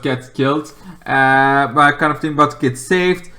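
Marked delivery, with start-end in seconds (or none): none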